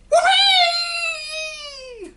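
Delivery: put-on shrieking old hag's whoop, very high and loud; cackling witch-like cry of glee; old hag shrieking with delight; screeching shout. A narrator's reading. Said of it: "she shouted".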